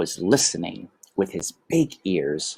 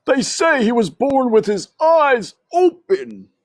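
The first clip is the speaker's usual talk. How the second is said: high, louder gossiping uncle mouse; Uncle Alfred; 6 dB louder than usual. A narrator's reading. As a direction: pipe up like a nosy old uncle. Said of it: "said Uncle Alfred".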